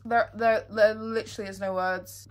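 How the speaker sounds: normal talking voice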